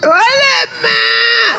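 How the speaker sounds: very high, long-drawn wail; theatrical cry of disbelief; mock dismay. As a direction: stretch it out in hammed-up exasperation. Mock dismay at opponent's answer.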